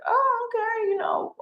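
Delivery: very high mock "oh, okay"; awkward high reply. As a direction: play yourself, politely uncomfortable. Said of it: "and I was like".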